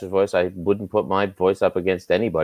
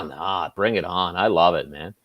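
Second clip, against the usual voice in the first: ordinary delivery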